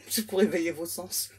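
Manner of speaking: normal talking voice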